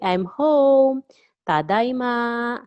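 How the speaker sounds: calling out. Acted. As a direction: announce your arrival to the household. Calls out on coming home.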